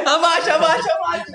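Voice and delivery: laughing high shout to duck; mock alarm. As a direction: yell take cover while laughing. Joking about a terrorist attack. (grito de alerta rindo)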